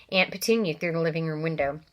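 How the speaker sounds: usual speaking voice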